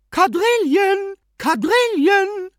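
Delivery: very high, loud, slow excited call; shrill excited cry drawn out. A narrator's reading. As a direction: sing it out to summon the room. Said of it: "klatschte aufgeregt in die Hände und rief".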